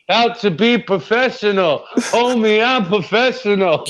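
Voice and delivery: a hint of a cocky rapper boasting. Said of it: "I'll be like [name]".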